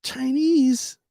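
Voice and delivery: high, incredulous one-word question; can't believe it's Chinese food; puzzled. Retold or imitated. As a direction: narrator asking the room, high and puzzled. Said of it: "I said".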